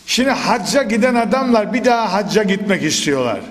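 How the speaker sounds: ordinary talk, nothing marked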